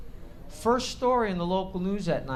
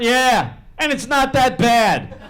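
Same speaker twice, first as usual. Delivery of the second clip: louder, slow sarcastic goodbye; mocking dad, louder; 7 semitones higher, 11 dB louder, slower than usual. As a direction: throw his own words back at him. Dad mocking son's words.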